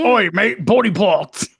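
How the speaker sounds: high, loud hammed-up Australian; Oi, mate in a jokey Aussie voice; mock Australian accent. Joking about the French title, in a mock Australian accent. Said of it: "I always just do Australian".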